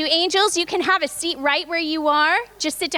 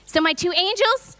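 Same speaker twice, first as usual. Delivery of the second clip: usual speaking voice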